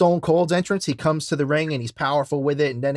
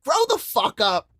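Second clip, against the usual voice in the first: angry retort; 10 semitones higher, slower than usual. Retold or imitated. Her yelling, retold.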